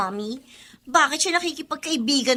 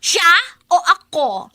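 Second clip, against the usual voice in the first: jealous demand; 8 dB louder, slower than usual. Acted.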